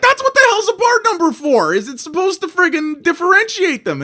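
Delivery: disbelieving, voice shooting way up; exasperated outburst. Retold or imitated. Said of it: "I'm like".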